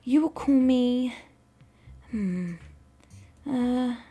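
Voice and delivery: high, drawn-out pondering; hesitant alien naming. Acted. The recruit picks another name for the narrator, pondering high. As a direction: hum and search for a name, then land on it.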